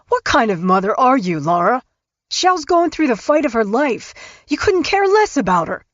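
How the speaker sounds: righteous outrage on his wife's behalf; indignant husband. A narrator's reading. Husband on the phone scolding his mother-in-law, indignant and rising. Dave angrily defends his wife.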